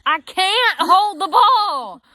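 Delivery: high, slow, slurred drugged whine; drugged protest. Retold or imitated. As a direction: whine it out as if half passed out. Woozy under anesthesia, imitating herself.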